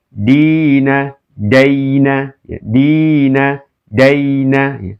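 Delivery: "dina" drawn out long, "daina" short; long vowel versus lin. Shown as a demonstration. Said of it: "kasroh bertemu dengan ya sukun di ayun".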